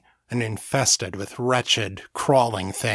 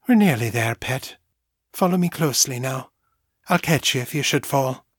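comforting motherly Southmorean lilt; lilting Southmorean accent. A narrator's reading. Mother guides her child down, lilting Southmorean accent. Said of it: "Her lilting Southmorean accent was as warm and comforting".

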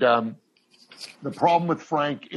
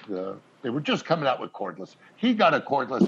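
ordinary delivery

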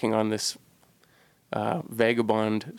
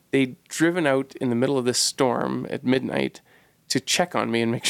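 ordinary talk, nothing marked

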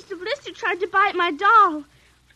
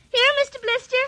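calling the dog; 8 semitones higher than usual. Acted. Girl calling the puppy.

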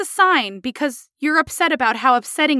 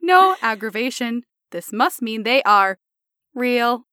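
halting, emotionless robot. Acted. Patient proving her symptoms are real, robotic voice. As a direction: pause between phrases like a machine. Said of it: "I'll try it again as a robot".

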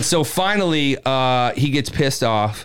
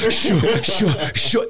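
repeated high "shoo" puffs; rhythmic vocal sound effect of a sprint; imitated running noise; 7 semitones higher, much slower than usual. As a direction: mimic running heard over a phone, whoosh by whoosh. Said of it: "you could hear it on the radio".

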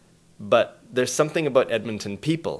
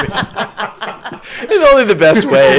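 laughing; 12 semitones higher, 16 dB louder, much slower than usual. Real, not acted. Laughing back at condescension remark.

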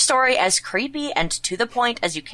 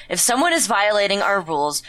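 ordinary delivery

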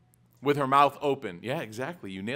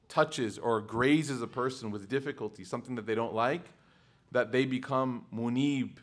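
normal talking voice

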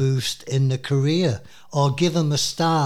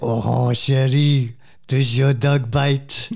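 hammed-up comic French accent; mock French accent; 4 dB louder than usual. Host showing off French to co-host, hammed-up French accent. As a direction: put on a silly French accent, Peter Sellers style. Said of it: "I can speak French too".

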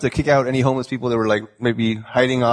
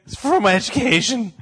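voice cracking high; 9 semitones higher than usual. Real, not acted. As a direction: voice cracks way up like puberty. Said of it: "As well as hitting puberty, apparently".

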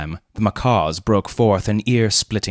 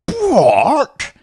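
higher, louder outraged shout; 7 dB louder than usual. A narrator's reading. Colonel hearing his wife is a wolf, outraged shout. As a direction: roar it in disbelief. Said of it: "shouted Colonel Hampton".

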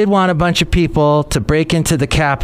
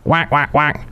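short, raised duck call; duck quack; 2 semitones higher than usual. Acted. Mystery bird calling out to the puzzled host, duck quack. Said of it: "He kinda sounds like a duck".

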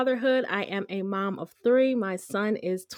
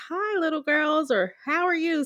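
put-on bright, higher friendly greeting to kids; imitating store man; 7 semitones higher than usual. Store worker greeting two toddlers, higher friendly voice. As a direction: mimic a cheery stranger.

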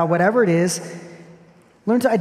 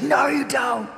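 put-on much higher, yelled child's no; daughter's defiant retort; 8 semitones higher, slower than usual. Daughter shouting back at her dad, defiant. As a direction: fire it back like a stubborn little girl.

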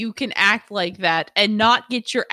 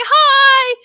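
bright, higher and louder calling-out hi; cheerful hello; 12 semitones higher, 9 dB louder than usual. Retold or imitated. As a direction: call across the yard to a stranger. Greeting neighbors on walk.

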